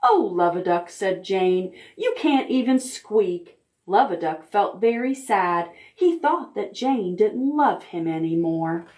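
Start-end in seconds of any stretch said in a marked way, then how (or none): none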